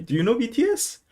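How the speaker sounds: very high question, climbing on the repeat; mock eager questioner. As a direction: badger the driver with put-on enthusiasm. Acting out question to taxi driver.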